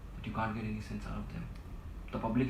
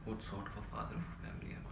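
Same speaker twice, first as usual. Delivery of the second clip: whispering; 8 dB quieter than usual. Acted. Weary self-pity before outburst.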